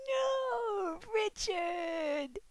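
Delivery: hammed-up heartbreak; mock anguish. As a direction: cry out in dramatic despair. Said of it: "I'm just like".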